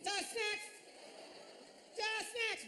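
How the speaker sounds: "Just next" squawked high as one mashed word; mimicked authoritative squawk of "Just next"; confident garbled call. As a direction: call "Just next" high, mangled but sure of itself. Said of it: "With confidence and authority".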